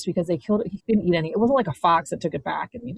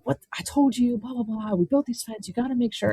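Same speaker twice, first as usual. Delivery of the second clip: higher-pitched mock scolding; retold irate husband; 5 semitones higher than usual. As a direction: imitate a pissed-off husband's rebuke. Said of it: "He immediately gets pissed".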